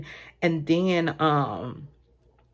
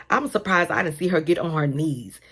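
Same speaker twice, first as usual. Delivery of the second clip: normal talking voice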